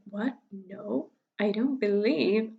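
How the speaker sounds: normal talking voice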